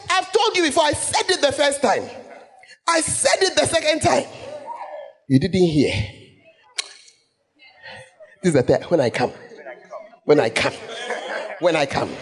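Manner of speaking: a playful Ghanaian scolding that keeps repeating "when I come"; Ghanaian way of speaking. Retold or imitated. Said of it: "it's a very Ghanaian way".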